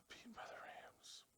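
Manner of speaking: a pained whisper, barely audible; dejected whisper. Real, not acted. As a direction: whisper it like it still hurts. His team lost, sighs.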